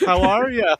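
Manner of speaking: mock talk-show host. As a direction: needle the guest with a big fake welcome. Riffing as Letterman needling the guest.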